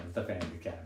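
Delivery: usual speaking voice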